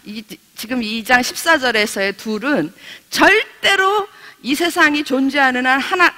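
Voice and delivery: ordinary talk, nothing marked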